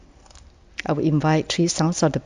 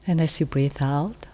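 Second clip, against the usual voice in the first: guided meditation; 4 dB quieter than usual. Real, not acted. Meditation leader inviting the group to settle and let go, calm and unhurried. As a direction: gently lead sitters into rest, phrase by phrase.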